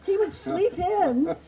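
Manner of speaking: high and loud through laughter; laughing. Real, not acted. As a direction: laugh while telling it. Laughing while recalling student.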